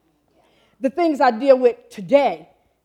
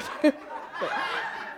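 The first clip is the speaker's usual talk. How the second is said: breathy laugh; 4 semitones higher, 7 dB quieter than usual. Real, not acted. Laughing at her own slip.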